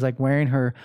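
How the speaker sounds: ordinary delivery, nothing marked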